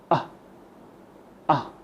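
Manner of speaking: short relaxed uh sound, lips loose; relaxed schwa grunt. Shown as a demonstration. Teacher demonstrating the schwa to students, short relaxed uh. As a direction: let out one short relaxed uh. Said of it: "you do it like this".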